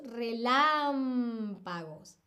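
relámpagos with LÁM stretched long; stressed LÁM. Shown as a demonstration. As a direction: dictate relámpagos slowly, holding LÁM. Teacher sounds out stressed syllable.

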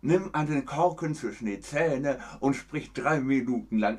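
words pushed past a cork held in the teeth. Shown as a demonstration. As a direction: speak with a cork between the teeth. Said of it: "Das ist dann also Bühnendeutsch".

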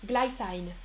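glycine said the English way, glai-sain; English-style glycine. Shown as a demonstration. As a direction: glycine with long English I sounds. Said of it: "Je prononce". (glycine prononcé à l'anglaise, glaïssaïne)

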